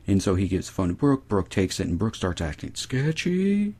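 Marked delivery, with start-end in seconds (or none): 2.7-3.7 sketchy, drawn out and rising high; mock sketchy. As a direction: stretch out "sketchy" in a high, suggestive tone. Mimics the sketchiness while retelling.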